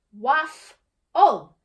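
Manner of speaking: Waff-ul in two slow separated beats; clapped syllables. Acted. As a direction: clap out Waff and ul apart. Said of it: "got her hands ready to clap".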